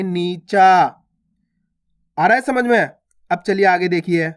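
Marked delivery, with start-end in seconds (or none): 0.0-1.0 long ee and long aa in नीचा; stretched vowels. Shown as a demonstration. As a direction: draw out both halves of नीचा. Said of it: "आ की मात्रा के साथ खींचेंगे". (नीचा में ई और आ खींचकर)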